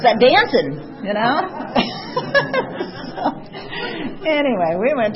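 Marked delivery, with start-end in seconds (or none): 1.5-3.1 a high, bursting laugh; real laugh, high and free. Real, not acted. Laughs at her own story.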